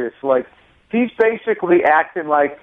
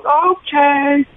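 crowd yell; 12 semitones higher than usual. Retold or imitated. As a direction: shout it out over the crowd. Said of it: "And somebody else yells".

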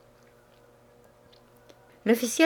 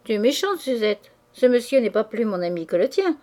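ordinary delivery

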